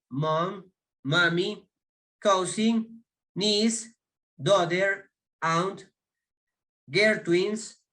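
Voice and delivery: each English word said on its own, clear and lifted, for students to repeat; pronunciation drill. Shown as a demonstration. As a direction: model "mother", "niece", "teen" one by one, carefully. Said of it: "voy a darles la pronunciación". (lista de pronunciación, cada palabra clara y separada)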